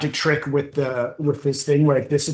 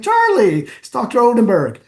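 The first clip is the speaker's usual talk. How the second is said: high, loud, emphatic call of the name; pointed hint; 8 semitones higher, 5 dB louder than usual. Retold or imitated. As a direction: nudge him insistently, pitched way up. Prompting the mayor to remember.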